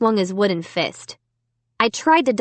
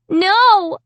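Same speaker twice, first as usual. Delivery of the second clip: high, loud defiant cry; 12 semitones higher, 6 dB louder than usual. A narrator's reading. Amy refusing the dummy, a loud high cry. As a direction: refuse him at full voice. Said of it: "I cried".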